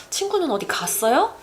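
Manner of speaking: 갔어요 punched after a quick, light 어디; stress on 갔어요. Shown as a demonstration. Asking if your friend went somewhere, stress on 갔어요. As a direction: skim over 어디, lift 갔어요 as a yes-no question. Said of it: "If I place the emphasis on the 갔어요".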